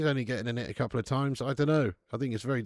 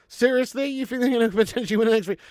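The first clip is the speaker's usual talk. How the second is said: high, fast, loud disbelief; incredulous; 10 semitones higher, 8 dB louder, faster than usual. Real, not acted. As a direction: scoff at the idea. Disbelief at a viewer's claim.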